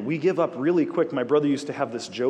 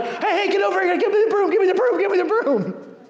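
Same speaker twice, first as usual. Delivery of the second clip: much higher, louder frantic yelling; panicked mechanic; 7 dB louder than usual. Retold or imitated. Startled mechanic yelling at coworkers for the broom, high panicked shout. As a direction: scream for the broom like a badger just stared back. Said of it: "And he's like".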